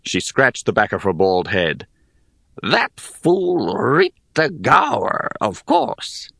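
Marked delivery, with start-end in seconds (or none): 2.6-6.3 put-on slow scornful old hag. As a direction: sneer it like an ancient hag. Hag names the spirit.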